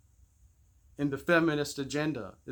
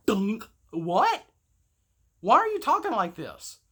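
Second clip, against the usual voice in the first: mock shocked parent; 6 semitones higher, 4 dB louder than usual. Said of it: "our mouths were like".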